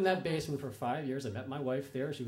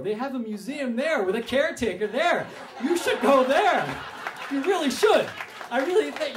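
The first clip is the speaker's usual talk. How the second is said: urgent pleading; 8 semitones higher, 8 dB louder than usual. Retold or imitated. Urging man to go to Baltimore.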